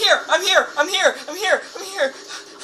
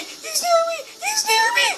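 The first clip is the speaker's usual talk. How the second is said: high panicked squeal; 12 semitones higher than usual. Real, not acted. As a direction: squeal it out in terror. Monster close, panicking.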